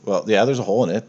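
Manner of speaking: usual speaking voice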